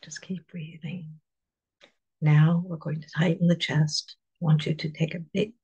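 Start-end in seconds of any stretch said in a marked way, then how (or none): 0.0-1.2 soft unvoiced whisper. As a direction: breathe the words out without voice. Repeats the phrase, whispered.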